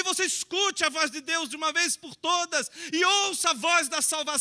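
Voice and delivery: loud, rising fervent sermon build; preaching at full force. Acted. Preacher urging the congregation to answer God's call, full-force preaching.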